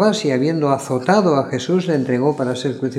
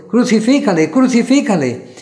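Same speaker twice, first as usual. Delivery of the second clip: high, loud repeated mob cry; crowd shouting; 7 semitones higher, 5 dB louder than usual. A narrator's reading. Crowd to Pilate, a loud high repeated shout. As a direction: hammer it twice like a mob. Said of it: "volvieron a dar voces diciendo".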